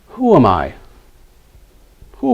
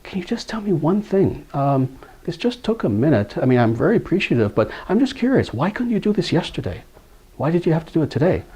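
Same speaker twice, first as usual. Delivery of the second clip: softer, hushed question, leaning in close; a curious mother confides, hushed and softer; whispering; 5 semitones higher, 5 dB quieter than usual. Retold or imitated. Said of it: "she leaned into him and she whispered".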